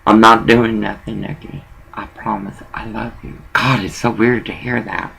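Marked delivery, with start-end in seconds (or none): 0.0-3.4 low, quiet pleading promise; soft pleading. Retold or imitated. Orville softly pleading with Nikki that he's innocent. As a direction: coax her gently, almost under the breath. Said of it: "Orville says".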